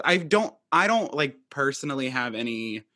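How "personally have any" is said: ordinary delivery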